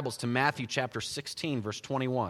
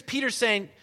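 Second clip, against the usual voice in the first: ordinary talk, nothing marked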